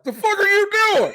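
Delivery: high, loud shocked yelp; shocked shriek. Retold or imitated. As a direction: shriek it in disbelief. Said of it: "she was like".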